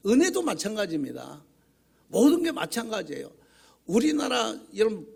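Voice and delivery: loud, high burst of preaching fervor; a preacher shouting out his call for passion; fiery preaching. Acted. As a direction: drive the point home to the congregation. Preacher's fired-up exhortation.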